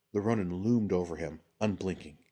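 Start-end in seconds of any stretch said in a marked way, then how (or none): none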